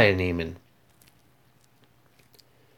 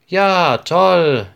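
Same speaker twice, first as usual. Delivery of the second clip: higher, louder joyful call; a class of kids calling out in delight; excited cheer; 7 semitones higher, 4 dB louder than usual. Acted. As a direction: cheer it out like eager schoolkids. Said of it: "rufen viele Kinder".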